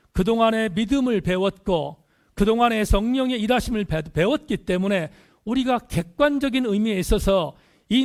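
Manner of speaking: emphatic pulpit delivery; forceful preaching. Real, not acted. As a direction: drive the point home to the congregation. Preacher presses the point forcefully.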